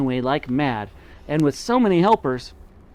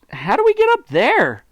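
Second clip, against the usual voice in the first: small monkey voice; 12 semitones higher, 6 dB louder than usual. A narrator's reading. Children asking, high voice.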